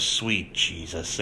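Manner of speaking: low, slow exasperated aside; imitated weary disbelief. Host quoting man's reaction in the car, low weary disbelief. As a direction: let it sink out slowly, mock exasperated.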